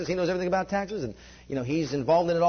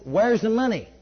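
demanding; 5 semitones higher, 5 dB louder, slower than usual. Retold or imitated. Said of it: "pull you by the side and said".

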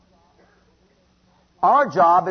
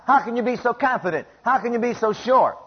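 higher-pitched, pressing questions; challenging devil; 5 semitones higher than usual. Acted. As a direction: question their confidence as the devil. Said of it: "the devil says".

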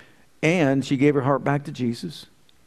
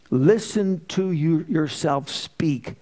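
slow stern voice of God; 3 semitones higher, much slower than usual. Retold or imitated. The Lord rebuking the preacher over his words. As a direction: spell out each word like a stern Lord. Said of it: "the Lord said".

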